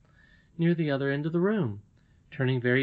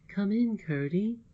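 lightly voiced, higher inviting lady; a hint of a gentle summoning voice; mysterious lady's voice; 8 semitones higher than usual. The mysterious voice calls to Curdie.

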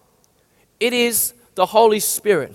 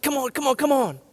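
hammed-up impatient urging, higher; mock pushy urging; 4 semitones higher, 5 dB quieter than usual. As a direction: imitate a pushy preacher egging on the crowd. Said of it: "real results-based".

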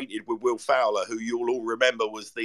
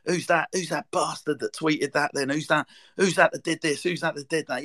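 imitating an irate Scouse bloke, slightly raised pitch; lightly put-on Scouse rant, not a full shout; mock Scouse shouting; 4 semitones higher than usual. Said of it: "in a Scouse accent shouting at the top of his voice".

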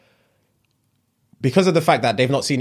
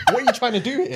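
high mock voice; 9 semitones higher, faster than usual. Mimicking the reaction.

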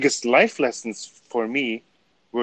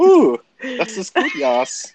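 put-on high, slow German accent; 10 semitones higher, slower than usual. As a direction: Ooh das ist gut in a hammed-up German accent. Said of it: "with my German accent".

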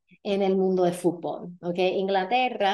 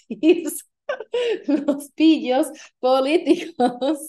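laughing through it; 8 semitones higher, 4 dB louder, slower than usual. Real, not acted.

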